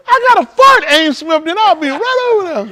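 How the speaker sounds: very high squeaky grandma; mock grandma. Imitating grandma promising to come over, very high. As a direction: squeal it like a flustered old lady.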